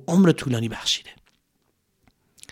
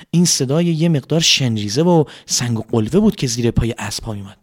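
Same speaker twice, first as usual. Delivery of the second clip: usual speaking voice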